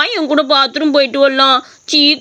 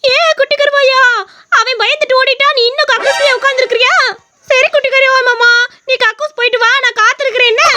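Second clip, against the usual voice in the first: haunt them in a high ghostly voice; the toilet ghost scaring the boy in a high shrill voice; 7 semitones higher than usual. Acted.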